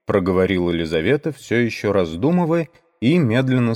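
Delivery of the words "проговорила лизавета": plain reading voice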